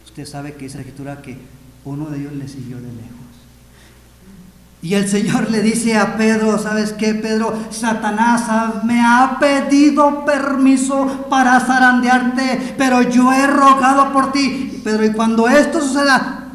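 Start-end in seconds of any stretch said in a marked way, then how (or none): 4.8-16.5 loud, high, urgent proclamation; impassioned sermon shout; full-force preaching. Acted. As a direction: proclaim the warning to Peter at full volume. Preaches Jesus's warning to Peter.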